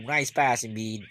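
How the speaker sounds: ordinary talk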